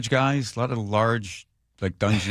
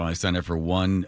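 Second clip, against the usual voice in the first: ordinary talk, nothing marked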